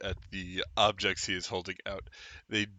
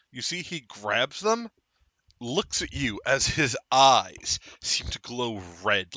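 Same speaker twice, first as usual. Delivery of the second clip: usual speaking voice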